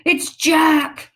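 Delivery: loud high terrified bellow. Acted. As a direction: bellow the name in panic. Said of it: "bellowed the flesh lump eater".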